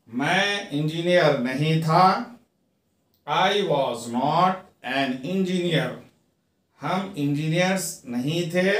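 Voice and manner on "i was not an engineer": very slow, each word apart; slow teaching read. Shown as a demonstration. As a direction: dictate it slowly to students. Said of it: "teacher reads model sentence".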